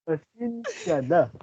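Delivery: high, slow copied pasensya; mock apology voice. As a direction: copy the pleading sorry slowly. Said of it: "Gagayahin ko".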